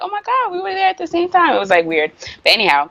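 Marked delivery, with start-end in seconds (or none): 0.0-1.5 high, fast retold amazement; acting out thrilled disbelief; so excited. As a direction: gush it in a giddy rush. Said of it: "I was so excited".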